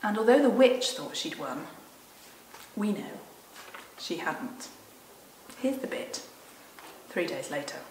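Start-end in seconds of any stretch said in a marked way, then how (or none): 2.8-7.8 whispered aside. Real, not acted. Confiding aside to listeners.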